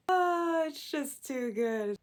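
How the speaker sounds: high, loud burst of delight; real amusement, drawn out and high; amused. Real, not acted. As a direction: crack up at your own joke. Cracks up at own pun.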